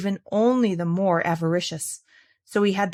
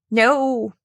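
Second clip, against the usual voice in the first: louder impish no; squeaky no; 3 semitones higher, 5 dB louder than usual. Acted. Little man refuses.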